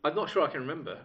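normal talking voice

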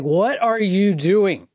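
high, slow mock-drunk demand; drunk scolding. Drunk fan confronting his new friend, drawn-out mock scolding. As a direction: stretch it out like an indignant drunk. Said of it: "X amount of drinks".

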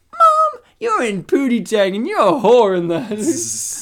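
high, loud mock protest to mom; outraged daughter. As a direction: wail it like a mortified kid, hammed-up. Voices a daughter scolding her mother.

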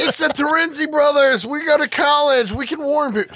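squeaky high voice; high mock character voice. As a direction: pitch up into a silly voice. Quoting characters from the film.

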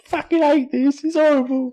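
very high whiny king voice; Henry the fourth voice. Acted. Co-host voicing the wet king, very high whine. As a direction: moan it like a spoiled king. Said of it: "same Henry the fourth voice".

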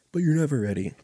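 mock wisdom. Mock sage wisdom to parents.

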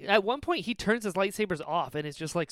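ordinary delivery

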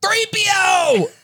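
hammed-up shout for a lost droid; hammed-up Han Solo calling out; mock Han Solo yell. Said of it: "Your Han Solo could use some work".

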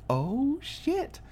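soft, high-pitched "oh" of realisation; mock stunned, high and quiet; hushed shock. Viewer realising she staged it, hushed mock shock.

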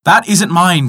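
crying out. A narrator's reading. Poor man refuses silver axe, mildly cried out. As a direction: call it out as a light protest. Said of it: "cried the poor man".

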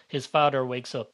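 ordinary delivery, nothing marked

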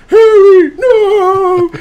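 high, loud, anguished wail of a name; Ben Affleck crying. Retold or imitated. Imitating Ben Affleck's big crying moment, loud and high. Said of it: "nobody cries like Ben Affleck".

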